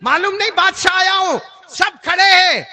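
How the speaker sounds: loud, high furious calling of the name; the king yells at the seated man in rage; furious royal shout; angry king. Acted. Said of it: "Haroon Rashid نے بڑے غصے میں کہا".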